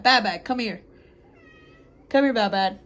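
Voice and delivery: calling the cat. Real, not acted.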